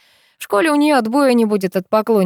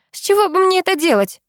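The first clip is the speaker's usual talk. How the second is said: high-pitched, snooty denial; haughty; 13 semitones higher than usual. A narrator's reading. Girl denying she would be jealous, haughty. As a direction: ask it loftily, pitch way up. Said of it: "задирает нос Люси".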